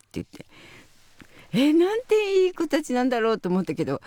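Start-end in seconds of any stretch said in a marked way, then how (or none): none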